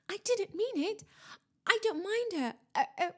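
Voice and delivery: high-pitched, flustered backpedalling; frantic. A narrator's reading. Student backtracks before the ghost arrives, frantic. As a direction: scramble to take it back, stumbling. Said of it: "Hermione whispered frantically".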